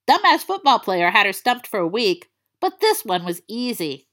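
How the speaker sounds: plain reading voice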